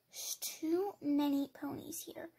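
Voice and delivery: hushed whisper, hiding. Real, not acted. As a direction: breathe the words so nobody hears.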